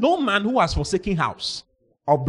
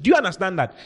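ordinary talk, nothing marked

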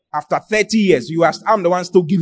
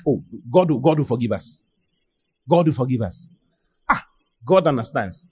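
lightly voiced complacent churchgoer, lower and softly dismissive; complacent believer; 6 semitones lower, 3 dB quieter than usual. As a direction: mimic a believer excusing themselves, pitched down a little. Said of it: "then you, you're like".

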